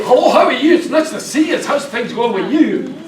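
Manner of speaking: mock broad Scottish greeting; strong Scottish accent. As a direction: put on a thick far-north Scottish accent. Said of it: "from further north in Scotland".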